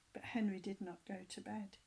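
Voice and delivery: usual speaking voice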